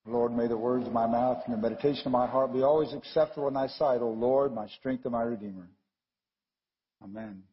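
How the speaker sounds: low, measured prayer voice. Real, not acted. Preacher opening the sermon with prayer, low prayer voice. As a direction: recite it reverently, pitched under normal talk.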